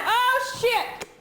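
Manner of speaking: high startled cry; panicked. Real, not acted. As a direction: blurt it out in panic. Bird flying about, panic.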